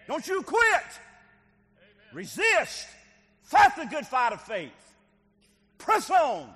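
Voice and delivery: loud, high, fervent preaching. Acted. Preacher urging the congregation to fight back, loud and fervent. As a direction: cry out to the church, don't quit.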